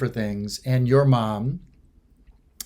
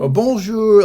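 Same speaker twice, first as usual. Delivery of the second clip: high, louder bright bonjour; cheery bonjour; 8 semitones higher, 8 dB louder, slower than usual. Retold or imitated. Copying Carol's greeting, high bright bonjour. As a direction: beam out a friendly bonjour. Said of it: "like that".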